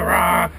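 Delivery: short loud roar; acted beast roar; griffin roar. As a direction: let out a burst of roar.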